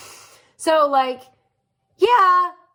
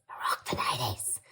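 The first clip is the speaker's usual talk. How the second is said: whispered through tears, much softer and lower; tearful whisper; 12 semitones lower, 11 dB quieter, faster than usual. Real, not acted. Telling viewers about her punk pants, tearful whisper. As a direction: let it catch in the throat. Proud confession fading into tears.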